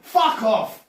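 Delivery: loud, high-pitched outburst; exasperated shout. Real, not acted. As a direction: explode at the teasing. Exasperated at being corrected.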